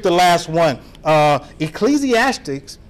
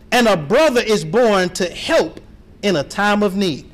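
ordinary delivery

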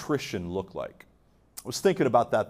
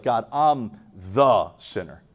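low voice, "the" drawn out and leaned on; stress on THE; 5 semitones lower than usual. Retold or imitated. Tax collector confessing to God, stressing "the". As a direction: set "the" apart heavily before "sinner". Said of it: "And he's like".

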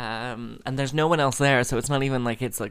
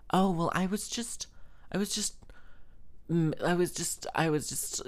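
higher, halting mock of an awkward woman; hesitant, stop-start imitation; imitating flustered backtracking; 6 semitones higher, 4 dB quieter than usual. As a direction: stammer apologetically in a raised voice.

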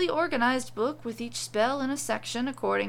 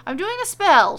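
high, loud, irritated snap; annoyed; 9 semitones higher, 11 dB louder than usual. A narrator's reading. Wife annoyed at husband's question, loud irritated snap. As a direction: brush him off, irritated he asked. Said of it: "annoyed that he questioned her".

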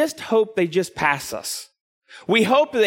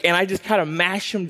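ordinary delivery

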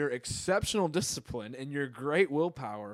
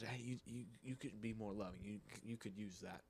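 gently quoting a kind suggestion, much softer; hushed, soft advice voice; soft-spoken; 12 dB quieter than usual. Retold or imitated. Quoting a gentle word of knowledge.

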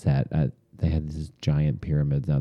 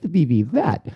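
high, loud fake French accent on each V; 10 semitones higher, 4 dB louder, slower than usual. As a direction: ham up a fake French tourist. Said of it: "term V".

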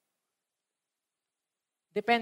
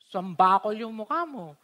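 ordinary delivery

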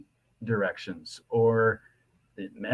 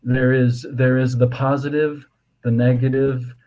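ordinary talk

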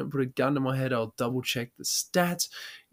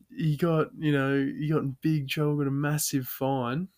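normal talking voice

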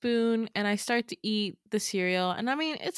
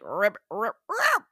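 tearing noise imitation; slower than usual. Retelling Becca eating the toad, mock ripping noise. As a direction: mimic the toad tearing, last one shooting up.

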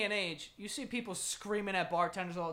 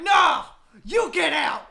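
loud, higher, slow shouted retort; angry shout; 8 semitones higher, 15 dB louder, slower than usual. Retold or imitated. Piano player shouting back at the gunfighter, loud and slow. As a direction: bellow it back across the room. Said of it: "It's like".

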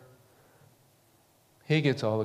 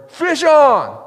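full shout, much higher and louder; loud yell; 11 semitones higher, 10 dB louder than usual. Shown as a demonstration. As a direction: yell it so the whole river hears. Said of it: "you gotta really yell it loud".

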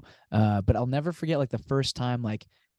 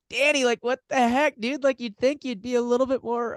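high, incredulous mock outrage; mock disbelief; 12 semitones higher than usual. Retelling his reaction to Danny in underwear, mock disbelief. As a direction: pitch up, playfully scandalized.

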